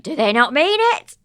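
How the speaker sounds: loud, high indignant comeback; snapping back at the boyfriend in disbelief; incredulous retort. Retold or imitated.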